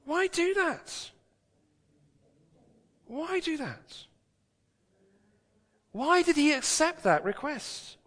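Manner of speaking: high, slow mock disbelief; lightly acted incredulity; incredulous onlookers. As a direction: voice the baffled onlookers. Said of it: "they would say".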